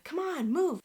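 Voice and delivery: high retold motherly prodding; insistent urging. Mom to daughter who is trying, insistent urging. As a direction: imitate a mom pushing for movement.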